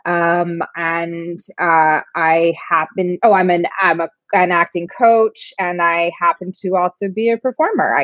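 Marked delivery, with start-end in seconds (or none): none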